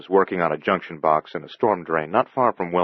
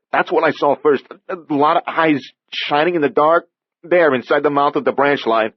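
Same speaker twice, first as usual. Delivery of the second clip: put-on higher, louder young man, spooked; young repairman recalling the eyes, higher and louder; frightened Andy; 10 semitones higher, 6 dB louder than usual. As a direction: tell it still shaken.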